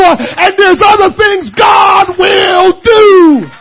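loud, high preaching shout; full-force preaching. Acted. Pastor preaching hard to the congregation, shouting. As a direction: preach it to the rafters.

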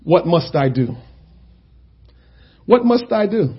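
soft, low, hushed plea; quiet prayer. Real, not acted. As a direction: murmur it as a private prayer. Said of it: "we need to ask ourselves".

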